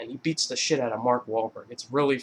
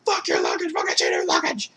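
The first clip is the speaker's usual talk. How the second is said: frantic high mock shout; Jonah Hill; 8 semitones higher than usual. Fleeing man shouting at his friend, frantic mock yell. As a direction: yell it back in a straining panic.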